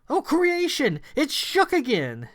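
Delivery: high, loud startled cry; dismayed exclamation. Acted. A hero spots Shook again, high startled cry. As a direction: cry out in alarm at the sight.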